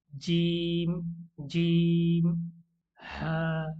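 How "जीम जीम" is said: जीम said louder, clear j sound; जीम with a firm dj; letter jeem. Shown as a demonstration. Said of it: "हम practice करेंगे".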